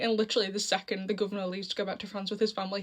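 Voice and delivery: ordinary talk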